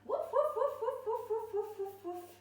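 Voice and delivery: high excited woofs, fading down; high dog woofs. Shown as a demonstration. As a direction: bark like an excited puppy. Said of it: "and wag that tail".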